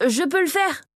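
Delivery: high-pitched, eager interjection; eager call. A narrator's reading. Kyo breaks in to volunteer, eagerly calling out. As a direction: pipe up to volunteer. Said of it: "lança Kyo".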